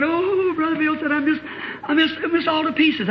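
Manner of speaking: high, tearful distress; distraught. Retold or imitated. Distraught girl telling the preacher she's in pieces, high tearful voice. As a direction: fall apart on the words.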